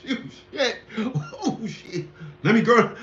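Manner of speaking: ordinary delivery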